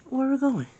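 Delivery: put-on high baby voice full of worry. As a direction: play a frightened little baby. Scared baby asks during storm.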